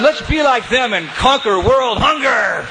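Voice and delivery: slow, high rousing laughing call. Retold or imitated. Mayor rousing the audience to conquer hunger. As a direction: end the speech with a high laughing flourish.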